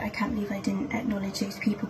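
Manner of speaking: hushed whisper of inner regret; breathy remembered thought. Retold or imitated. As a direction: whisper it to yourself in disbelief. Recalled inner panic, hushed.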